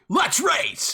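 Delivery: a hint of the racer's yell; mock catchphrase. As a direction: shout the catchphrase. Repeating the catchphrase.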